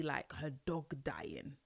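soft, low whisper. Real, not acted. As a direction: breathe it out as a hushed aside. Whispered aside.

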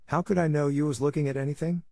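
put-on high, defensive young boy. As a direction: protest your innocence, boyish. Tom answers Becky defensively.

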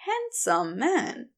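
ordinary delivery, nothing marked

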